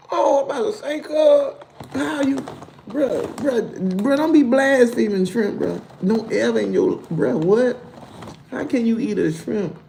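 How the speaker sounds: high, heated mock scold; playful high scolding. Real, not acted. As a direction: tell the viewer off, high-pitched. Scolding shrimp blasphemer.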